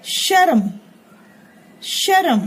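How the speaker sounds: शर्म said as "shar-am" with a full र and a vowel before म; शर्म drawn out as "sha-ra-m"; full र, spelled out. Shown as a demonstration. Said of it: "र पूरा है तो इसका उच्चारण कैसे होगा". (शर्म को "शरम" की तरह पूरा र बोलकर)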